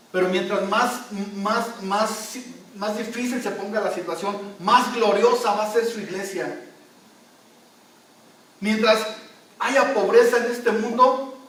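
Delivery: rising, loud preacher's build; preaching at full force. Acted.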